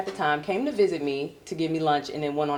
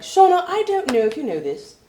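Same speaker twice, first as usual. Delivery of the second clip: mock British woman, pitched up; British coworker; 7 semitones higher, 4 dB louder than usual. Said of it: "she was like".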